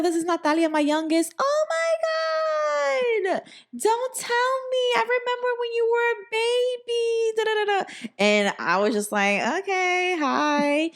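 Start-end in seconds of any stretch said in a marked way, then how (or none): 0.0-8.1 hammed-up auntie tearing up over a grown baby; gushing auntie. Mom's friend gushing over baby memories. 9.6-10.9 high, hesitant mock greeting; teen awkwardly greeting a stranger in a high voice. Awkward high reply to gushing woman.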